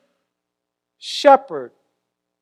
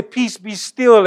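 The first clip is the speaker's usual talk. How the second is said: slow, loud and higher, a calm command; commanding rebuke; 4 semitones higher, 6 dB louder, slower than usual. Retold or imitated. Preacher voicing the word that calms crazy people, slow and loud. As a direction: speak it like you're stilling a storm. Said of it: "I want to say".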